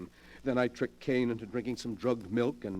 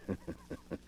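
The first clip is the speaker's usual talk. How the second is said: soft sinister chuckle; 10 dB quieter than usual. Acted. Villain laughs at them.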